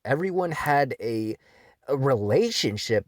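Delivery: ordinary talk, nothing marked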